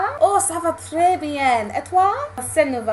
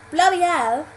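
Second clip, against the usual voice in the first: louder, higher British exclamation with dropped h; broad British accent; 4 semitones higher, 5 dB louder than usual. Shown as a demonstration. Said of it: "would sound particularly British".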